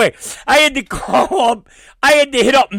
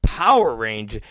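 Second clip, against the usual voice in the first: ordinary delivery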